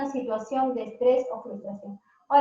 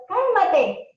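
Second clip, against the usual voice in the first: higher and louder, an exasperated command; desperate parent shouting at a child to calm down; desperate order; 6 semitones higher, 6 dB louder than usual. Shown as a demonstration. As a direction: bark it at an upset child. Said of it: "Por ejemplo".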